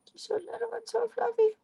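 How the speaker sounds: put-on high squealing baby talk; high cooing. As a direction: squeal it to the kitten. Cooing at cat, higher.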